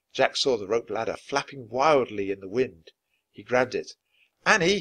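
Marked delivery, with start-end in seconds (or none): 4.5-4.8 high call of her name. Acted. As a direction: call her through the dark. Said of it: "he called".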